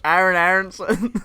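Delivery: delighted mock recital; mock kid voice. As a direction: savor the silly name. Friends repeating the joke.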